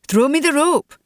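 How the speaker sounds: high-pitched call to someone above. A narrator's reading. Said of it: "Munro called up".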